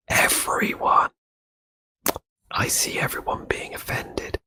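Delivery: slow, hushed, voiceless whisper; mock-eerie whisper. Streamer answers who the accent offended, mock-eerie whisper. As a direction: whisper it like a creepy confession.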